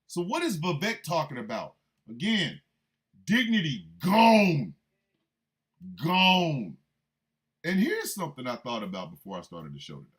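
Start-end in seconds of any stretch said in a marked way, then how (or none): 3.2-6.8 loud, drawn-out declaration; exasperated, booming pronouncement; emphatic proclamation. Real, not acted. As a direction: belt it out with a long vowel. Proclaims lost dignity, repeated.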